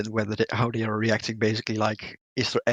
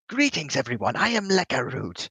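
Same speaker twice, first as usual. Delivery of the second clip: jovial merchant voice; 5 semitones higher than usual. Acted. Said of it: "like the jovial merchant voice".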